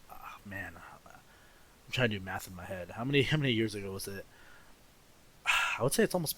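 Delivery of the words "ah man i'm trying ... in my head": whispering to himself while counting; soft unvoiced mutter; whispered aside. Real, not acted.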